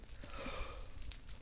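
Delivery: breathless shocked intake; sharp gasp. Real, not acted. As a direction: gasp at something sudden. Sudden shock in game.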